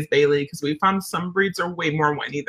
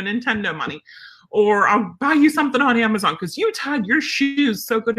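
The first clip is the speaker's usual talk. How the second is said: gushing praise; 3 semitones higher than usual. Pretend. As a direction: gush wildly over a tiny success, pitch climbing.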